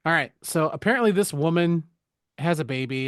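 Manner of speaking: ordinary talk, nothing marked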